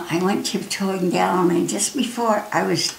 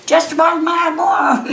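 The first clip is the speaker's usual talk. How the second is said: higher, louder call to the pilot behind; shouting over engine; 9 semitones higher, 3 dB louder than usual. Retold or imitated. Girl shouting directions to pilot in open cockpit, loud and high. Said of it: "with me shouting at him".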